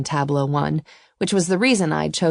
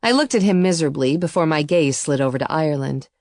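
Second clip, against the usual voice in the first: ordinary delivery, nothing marked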